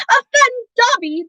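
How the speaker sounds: high, slow, choked-up squeak; choked elf cry. A narrator's reading. Dobby overcome at Harry's kindness, choked high cry. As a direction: gasp it through tears. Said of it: "choked the elf".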